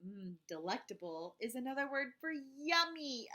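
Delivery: usual speaking voice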